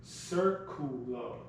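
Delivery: Circulo said slowly, Spanish vowels. Shown as a demonstration. Teacher slowly models Circulo for the class.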